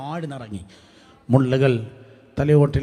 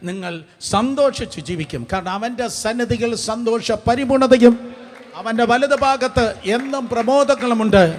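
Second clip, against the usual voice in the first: loud, raised preaching voice; full-force preaching; 6 semitones higher, 9 dB louder than usual. Real, not acted. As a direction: proclaim it to the whole congregation.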